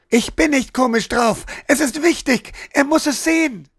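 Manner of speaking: high, shrill-pitched shout building up; child's outburst. A narrator's reading. Hannah bursts out at Sven, a high child's shout. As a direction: yell it like a stubborn little girl. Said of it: "brüllte sie mit einem Mal".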